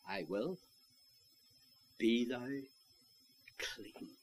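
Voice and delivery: soft, hushed, gentle words; tender reply. Retold or imitated. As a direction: let the healing word fade to a breath. Christ answers the leper.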